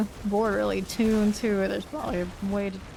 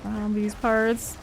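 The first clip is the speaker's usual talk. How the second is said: ordinary delivery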